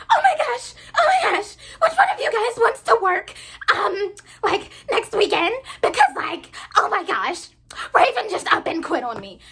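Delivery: high, loud, half-laughing panic; frantic manager. Retold or imitated. Manager panicking over a missing shift, high loud frantic voice. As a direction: mimic her frantic overreaction.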